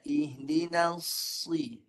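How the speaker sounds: "Ihdinas si-" slow with emphatic sad carried on; heavy sad. Shown as a demonstration. Ustaz showing the sad joined, slow. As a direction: keep the sad thick into si. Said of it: "dia sirkan".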